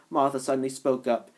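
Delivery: ordinary delivery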